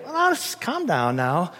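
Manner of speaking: slow, lightly voiced soothing dismissal; slow it right down, lightly placating; moralist placating. Moralist reassures a seeker, slow and placating. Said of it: "The moralist would just say".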